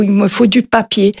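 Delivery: ordinary delivery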